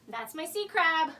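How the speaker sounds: ordinary talk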